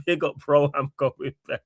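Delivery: light imitation of a friend's accent, breaking into laughter; Ends' accent. Host reads a chat message from Ends, lightly imitated accent. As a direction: read it in your mate's accent, cracking up. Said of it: "I read this in Ends' accent".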